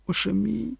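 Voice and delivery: high, thin whimper; sobbing. A narrator's reading. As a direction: sob it helplessly, pitch way up. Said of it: "схлипувала Кетрін". (схлипуючи)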